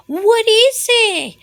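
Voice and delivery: slow, high sleepy question; sleepy high mouse. Acted. Mouse woken by Bear, slow high sleepy voice. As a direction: drawl it half asleep.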